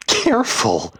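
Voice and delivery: loud, high, incredulous scoff; scornful, falling exclamation. A narrator's reading. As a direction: throw the word back with scorn. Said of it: "she scoffed".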